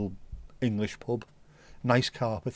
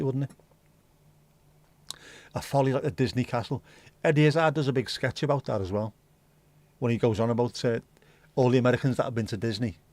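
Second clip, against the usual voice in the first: ordinary talk, nothing marked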